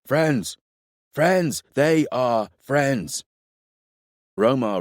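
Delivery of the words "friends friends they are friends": high, urgent shout of warning; frantic calling out. A narrator's reading. Makin waving frantically at the riders, calling out. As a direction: call it out high and insistent. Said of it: "waving his arms frantically, calling out".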